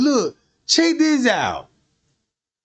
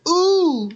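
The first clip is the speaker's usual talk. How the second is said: mock ooh; 9 semitones higher than usual. Imitating her reaction.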